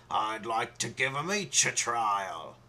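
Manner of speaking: a sly, slightly changed king voice; lightly voiced scheming king; shifty. King planning to try out every girl, sly. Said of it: "said with a shifty smile".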